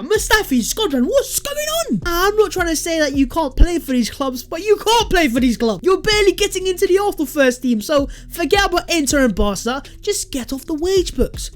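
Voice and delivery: exasperated rant. Acted. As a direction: scold him like a fed-up fan.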